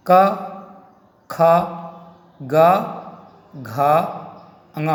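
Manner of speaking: each letter क, ख, ग, घ, ङ said alone and clearly, with a pause after each; consonant drill. Shown as a demonstration. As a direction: recite the letters one by one for pupils. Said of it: "अब यहाँ पे केवल".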